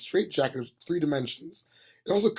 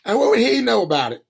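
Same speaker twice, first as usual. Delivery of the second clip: louder, higher scornful sneer; 8 semitones higher, 9 dB louder than usual. A narrator's reading. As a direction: scoff at the wizard. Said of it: "sneered Granny".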